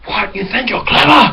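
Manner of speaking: hammed-up confrontation; angry man on the bus. The man getting off confronts the pranksters, mock angry. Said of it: "he went like".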